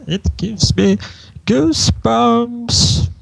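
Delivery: high, drawn-out shiver of delight; Discord. Acted. Discord reacts to the ear scratch, high and ticklish. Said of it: "Discord starts".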